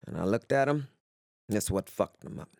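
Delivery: ordinary talk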